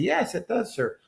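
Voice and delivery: a hint of a sweet attendant answer; flight attendant.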